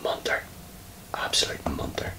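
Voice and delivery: soft whispered scorn; whispered insult. Real, not acted. Player mutters at his rival in a whisper, scornful. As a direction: hiss the insult under your breath. Insulting opponent under breath.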